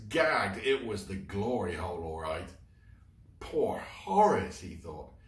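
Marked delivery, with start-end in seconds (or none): 3.4-4.6 pitying. Acted. Fergus pities trapped friend.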